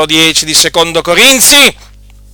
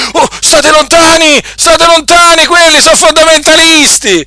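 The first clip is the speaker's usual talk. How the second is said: high, panicky cry of warning to keep away; mock alarmed warning; 13 semitones higher, 4 dB louder than usual. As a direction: mimic scared churchgoers shooing people off. Mocking opponents' fearful warning. (avvertimento allarmato e beffardo, acuto)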